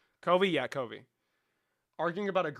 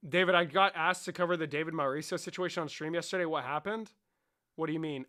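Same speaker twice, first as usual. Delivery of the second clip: ordinary talk, nothing marked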